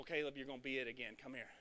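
ordinary talk

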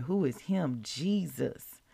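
ordinary talk

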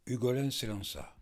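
ordinary delivery

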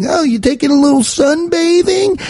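put-on high cooing baby talk; high coo at a sunbathing cat. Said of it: "be like".

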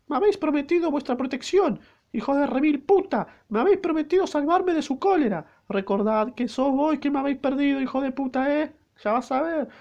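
put-on shrill-pitched young maid, cursing in fury; hammed-up falsetto maid raging at her betrayer; high maid voice. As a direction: go up high and spit out the insults. Reader voices an enraged maid.

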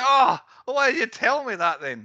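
loud, high exasperated protest; acted-out indignant outburst; retold exasperation. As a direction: complain as if arguing with the film. Retells exasperation at the film's plot.

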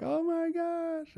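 high, drawn-out mock exclamation; slow, high-pitched retold squeal; imitated amazed cry. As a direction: imitate a stretched-out gasp of amazement. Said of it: "you did some, like".

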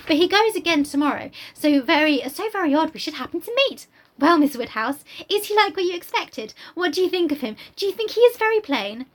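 eager Harriet. Acted. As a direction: gush it out like an excited young girl. Reads Harriet's lines aloud.

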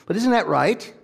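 high, emphatic rhetorical question; Derek Prince. Acted. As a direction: ask it in the high borrowed preacher voice. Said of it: "I hear it from him".

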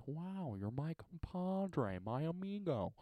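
soft, slow movie-star impression; hushed, drawn-out actor imitation; Owen Wilson. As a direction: murmur it slowly, stretched out. Acts Owen Wilson movie line.

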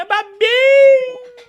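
high, drawn-out delighted cry; excited squeal. Real, not acted. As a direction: stretch the name out in joy. Excited recognition of caller. (grito empolgado e esticado)

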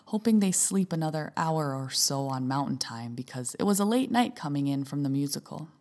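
calm reading of a written piece; essay reading. Acted. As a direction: read it like a personal column.

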